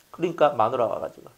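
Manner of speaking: normal talking voice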